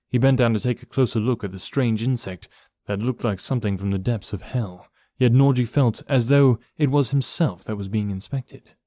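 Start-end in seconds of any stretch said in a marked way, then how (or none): none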